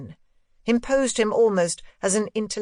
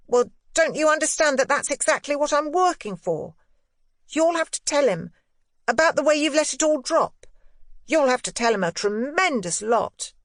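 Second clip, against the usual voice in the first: put-on high, triumphant boyish voice; a boy crowing over his win; exultant boy Miles; 7 semitones higher, 4 dB louder than usual. A narrator's reading. Boy exulting at his governess, high and triumphant. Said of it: "The exultation with which he uttered this".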